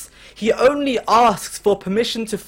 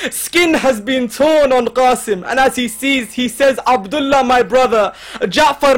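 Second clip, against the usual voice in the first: raised, grieving oratory building in intensity; impassioned preaching; 5 semitones higher, 5 dB louder than usual. Acted. Preacher telling of Abbas sending his brothers to fight, impassioned. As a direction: drive the lament upward at full voice. Impassioned lament over the fallen.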